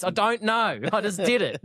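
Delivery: put-on high little kid voice, helpless; sheepish kid. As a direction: plead like a clueless child. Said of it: "Like when your dad's yelling at you".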